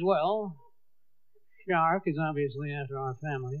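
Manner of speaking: a lightly voiced mother with a dumb plan. Mother explains the plan to family, low deadpan. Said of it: "She says".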